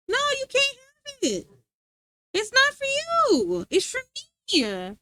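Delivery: high, slow sing-song refusal; teasing the dog. Real, not acted. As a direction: taunt the dog gently, drawn out.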